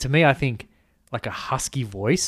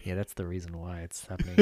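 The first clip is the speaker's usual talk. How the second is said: low, very soft know-it-all aside; muttered aside; 6 semitones lower, 13 dB quieter than usual. Retold or imitated. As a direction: mutter it from the background, under your breath. Imitating himself chipping in from background.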